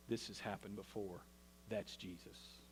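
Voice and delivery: low, soft dawning awe; hushed realization. Retold or imitated. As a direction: breathe it as it dawns on you. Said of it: "all of a sudden they realized".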